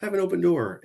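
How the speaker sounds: sarcastic stock phrase; mocking cliché. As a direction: parrot the empty boss line. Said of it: "I know that's just a".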